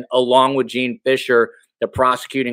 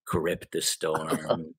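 low slow creepy monotone; slow ominous mock prophecy; creepy Chad voice; 7 semitones lower, 9 dB quieter, much slower than usual. Host mimics the Chad voice.

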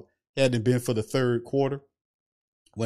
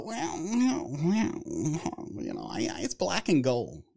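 stalling high "well, well", then drop and slow; Mickey Loomis wind-up; 3 semitones higher, 5 dB quieter, much slower than usual. Retold or imitated. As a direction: wind up slowly before answering. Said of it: "Mickey has a wind up".

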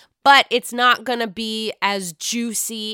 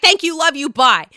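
loud, higher rushed goodbye; quick brush-off; 5 semitones higher, 8 dB louder than usual. Retold or imitated. Charisse ending the call with Robin, loud and breezy. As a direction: hang up fast and breezy.

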